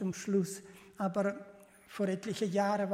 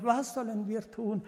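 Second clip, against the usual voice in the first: ordinary talk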